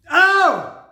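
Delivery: very high, very loud yelp of joy; real overjoyed squeal; delighted shriek. Real, not acted. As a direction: shriek it out with glee. Peak reaction to the record.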